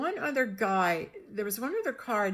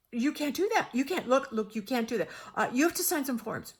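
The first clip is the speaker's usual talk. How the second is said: higher, louder flustered objection; retold urgent protest; acted insistence; 4 semitones higher, 5 dB louder than usual. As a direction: mimic an insistent nurse stopping a patient. Acted nurse insisting she stay.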